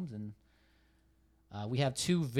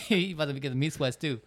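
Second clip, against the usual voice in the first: laughing; 4 semitones higher, 7 dB louder than usual. Real, not acted. Teasing joke while laughing.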